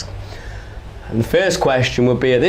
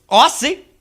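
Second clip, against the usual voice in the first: high, cocky street con man; high-pitched con man sizing up a mark; mixtape salesman; 8 semitones higher than usual. Acted. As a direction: read them like a hustling salesman. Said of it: "he took one look at the Somali and he went".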